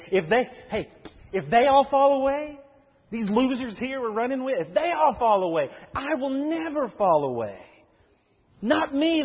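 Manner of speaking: high, loud, cocky boast of loyalty; boastful Peter. Acted. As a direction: brag that he'll never fall away.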